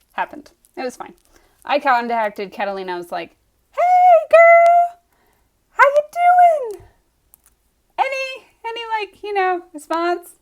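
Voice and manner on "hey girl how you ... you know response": very high, loud squeal, easing down as it turns to asking; over-eager greeting. Retold or imitated. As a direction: put on a squealy, too-friendly hello, then tentatively fish for news. Acts out over-eager follow-up call.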